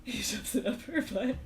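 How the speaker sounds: talking through real laughter; cracking up. Real, not acted. Teller joking about the handkerchief delivery, cracking up. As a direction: laugh helplessly, words squeaking out between bursts. Cracks up at own wrong guess.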